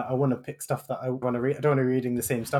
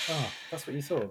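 1 deadpan sarcasm; 9 dB quieter than usual. Real, not acted. Deadpan after shocked what.

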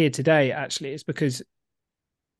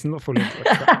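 a light put-on French accent, quick and a bit louder; 3 semitones higher, 5 dB louder, faster than usual. Refusing the dare to do French, lightly French-accented. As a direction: playfully dodge the bait in a hint of French. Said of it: "Say courage in a French accent".

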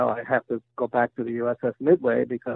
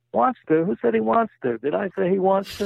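indignant punchline; 7 semitones higher than usual. Retold or imitated. Joke patient rebuffs the young doctor, mock outrage. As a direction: punch the joke's last line, outraged.

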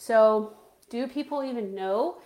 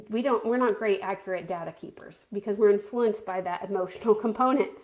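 ordinary talk

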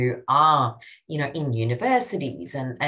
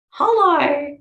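a high, loud, gleeful hello; bright mock-surprised greeting; excited hello; 11 semitones higher, 8 dB louder than usual. Real, not acted. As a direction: sing out hello like a reveal. Playful excited hello to listener.

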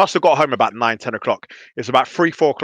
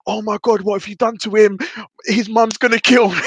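aunt panicking; 7 semitones higher than usual. Retold or imitated. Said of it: "She's like".

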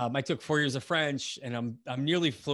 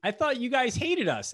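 much higher, surprised mock protest; surprised disbelief; 10 semitones higher, 4 dB louder than usual. To French locals, surprised they don't hate Americans, pitched up. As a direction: act amazed, voice jumping up high. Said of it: "And I'm like".

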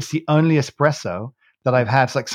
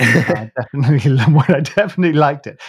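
louder, laughing through the words; 3 semitones higher, 7 dB louder than usual. Real, not acted. Admitting liking the forgotten coffee, laughing.

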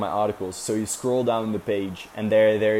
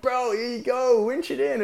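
much higher, bright helper voice; imitating Aito; 14 semitones higher than usual. Aito handing over the winch handle, high imitated voice. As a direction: hand it over like an eager mate.

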